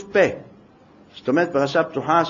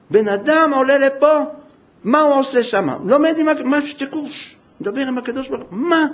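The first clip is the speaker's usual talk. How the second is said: higher, amazed questions; astonished angels; 9 semitones higher, 4 dB louder than usual. Acted. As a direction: gasp in disbelief as the angels. Angels astonished at Moses ascending.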